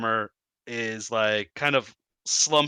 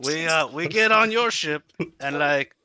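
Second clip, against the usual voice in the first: high, pleading kobold voice; 4 semitones higher than usual. Acted. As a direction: sell the deal like a kobold. Kobold bargaining in voice.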